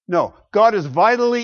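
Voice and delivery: ordinary talk, nothing marked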